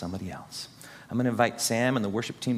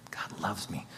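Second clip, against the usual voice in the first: a hushed, breathy affirmation; whispered; 6 semitones lower, 9 dB quieter than usual. Shown as a demonstration. Preacher demonstrating, whispering to congregation. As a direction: whisper it to yourself. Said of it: "Say it in different inflections".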